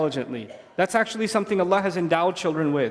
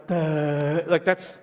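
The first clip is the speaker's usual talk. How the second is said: put-on dim toddler; 5 semitones lower, much slower than usual. Slow child voiced dumbly.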